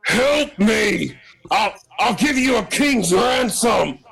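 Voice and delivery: high, desperate strained yell; yelling out for help. Acted. Grenlich begging both players for rescue, high desperate yell. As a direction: plead in a shrieking, high-pitched cry. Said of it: "he's going to yell out".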